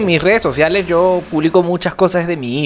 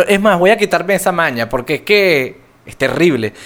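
ordinary delivery

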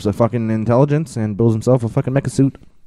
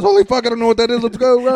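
high, fast Rick impression; 13 semitones higher, 3 dB louder, faster than usual. Cohost imitating Rick running from the creature, high and fast. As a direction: rattle it off high and fast in a panic. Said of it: "He goes".

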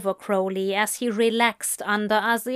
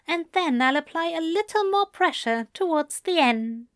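higher, soothing and sly; teasing Aziraphale voice; 6 semitones higher than usual. Acted. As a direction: tease gently like a doting angel. Aziraphale teasing the sleepy Crowley.

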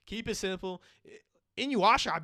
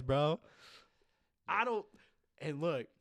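usual speaking voice

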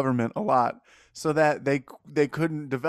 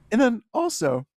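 voice cracking; 9 semitones higher, 5 dB louder than usual. Real, not acted. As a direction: voice cracks high on "also". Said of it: "'cause my voice cracked".

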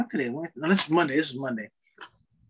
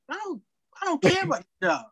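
much higher defiant retort; 12 semitones higher than usual. Retold or imitated. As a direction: toss it up high, not caring at all. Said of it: "I'm like".

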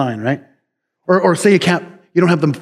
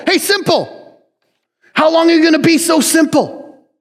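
high taunting call, much higher; mocking Wisdom; 13 semitones higher than usual. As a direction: call out to a fool teasingly. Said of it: "Some translations say simple".

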